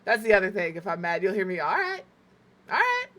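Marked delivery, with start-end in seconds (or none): 1.6-2.0 high-pitched rising all right; first step up into a high, irritated voice; mad, getting higher. Shown as a demonstration. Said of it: "It gets higher and higher". 2.7-3.1 extremely high squeaky all right; mad, helium-high. Shown as a demonstration. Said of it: "sound like I sucked helium".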